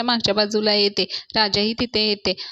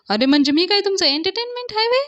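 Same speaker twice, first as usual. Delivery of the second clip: mock outrage; 9 semitones higher than usual. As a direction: bellow it like an offended old man. Anna outraged at being entertainment.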